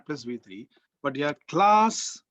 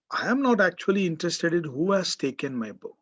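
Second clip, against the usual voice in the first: ordinary talk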